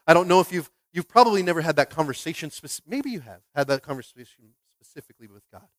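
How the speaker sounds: ordinary talk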